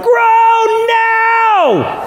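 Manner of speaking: sudden high shout; impatient demand. Retold or imitated. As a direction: yell it at life to grow right now. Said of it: "I'm like".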